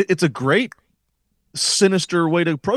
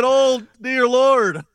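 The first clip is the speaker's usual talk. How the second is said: high, drawn-out mock preacher pleading; preacher's prayer; 9 semitones higher, slower than usual. Wrestling analyst imitating a wrestler's confession to the Lord, hammed-up preacher. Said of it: "like Brotherhood and Brother LeBar".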